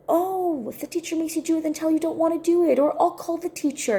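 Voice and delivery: high-pitched mock fussing over a child; over-helpful. Aunt rushing to fix niece's school trouble, over-helpful. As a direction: imitate an eager rescuer, pitched high.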